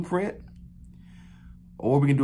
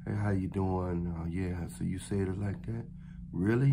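low deep tone; 5 semitones lower, 8 dB quieter than usual. Shown as a demonstration. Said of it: "low, deep tone of voice".

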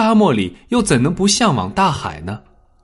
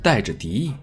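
ordinary delivery

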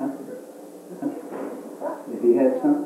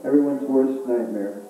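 normal talking voice

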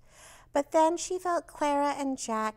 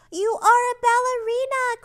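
much higher and louder cry; cried out; 11 semitones higher, 10 dB louder than usual. A narrator's reading. Children cheering on Brontorina, loud excited cry. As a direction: shout it out in excitement. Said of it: "cried Clara and Jack".

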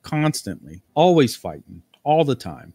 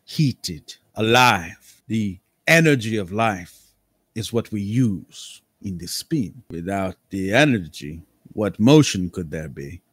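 eccentric nobleman =1; slower than usual. Acted. Lord Cambria speaks.

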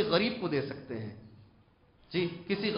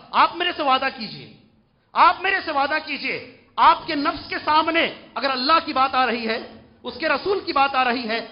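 raised preaching voice, pressing hard; forceful exhortation; 7 semitones higher, 8 dB louder than usual. Acted. Preacher asking young men for a promise, forceful exhortation. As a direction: demand a promise from the crowd.

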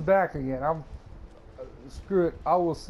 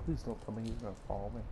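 nervous mutter; 4 semitones lower, 11 dB quieter, much faster than usual. Real, not acted. Afraid of pursuer.

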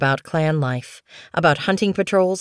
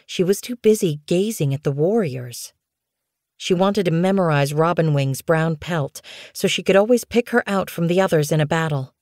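ordinary delivery, nothing marked

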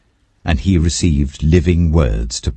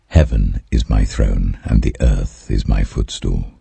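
lower voice of the Lord; 8 semitones lower than usual. A narrator's reading. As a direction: speak as the Lord, deep and unhurried. Quoting the Lord through prophet.

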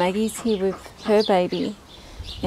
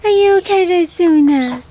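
high pet voice; 10 semitones higher, 9 dB louder than usual. Real, not acted. Checking on her duck Suna, high fussing coo. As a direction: fuss over the duck up high.

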